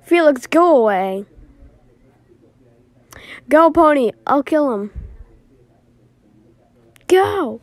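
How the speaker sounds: loud desperate commands; shouting. Acted. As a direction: yell to save her. Fight, sending friend off.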